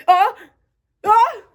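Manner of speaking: rising loud ah-ah; sneeze build-up. Acted. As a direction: wind up a huge sneeze. Elephant about to sneeze.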